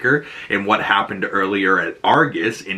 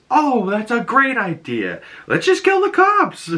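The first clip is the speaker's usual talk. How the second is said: high, sarcastic put-on voice, climbing much higher at the end; mock sarcastic agreement; 11 semitones higher than usual. Vigilante mockingly cheering on the plan, high sarcastic voice. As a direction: play it as a dopey over-eager yes. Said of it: "And Vigilante's like".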